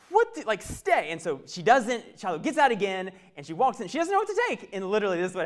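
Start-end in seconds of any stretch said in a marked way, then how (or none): 0.1-1.1 exasperated mom. Retold or imitated. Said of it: "and she's like".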